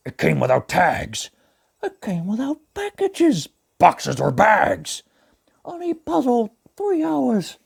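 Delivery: gentle cartoon bear narrating; Pooh Bear voice. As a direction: read it as a gentle honey-loving bear. Pooh Bear reads the story.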